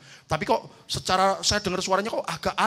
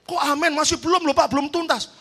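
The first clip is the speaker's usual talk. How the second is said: surprised protest; 9 semitones higher, 6 dB louder than usual. Retold or imitated. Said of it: "saya bilang".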